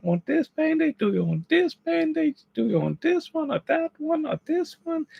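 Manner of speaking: very high, rushed mock panic with a gasp; frantic wife. Wife fussing over which band-aid, in a very high mock panic. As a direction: squeak out the wife's flustered fussing.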